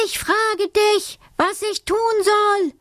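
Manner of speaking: mock shout. A narrator's reading. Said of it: "schrie Alice ganz laut".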